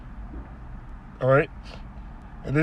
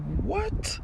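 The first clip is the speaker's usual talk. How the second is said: indignant what; 9 semitones higher, 6 dB quieter than usual. Retold or imitated. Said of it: "He was like".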